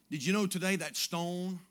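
ordinary delivery

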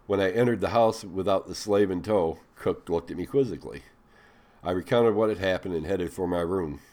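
ordinary delivery

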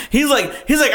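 ordinary delivery

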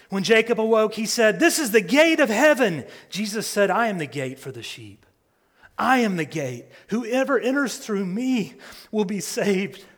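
ringing sermon climax; full-force preaching. Real, not acted. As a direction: preach it out with fire. Sustained loud preaching.

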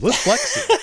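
laughing. Real, not acted. Punchline, laughing.

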